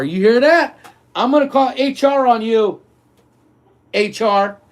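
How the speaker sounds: half-joking callout; mock threat.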